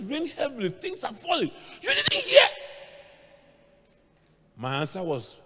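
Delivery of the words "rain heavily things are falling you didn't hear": panicked mother. Retold or imitated. Mother panicking over rainstorm.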